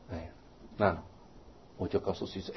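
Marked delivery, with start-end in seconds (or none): none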